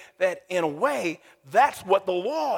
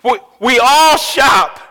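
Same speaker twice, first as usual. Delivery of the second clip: loud slow shout; 3 semitones higher, 10 dB louder, slower than usual. Real, not acted. Preacher shouts a new point.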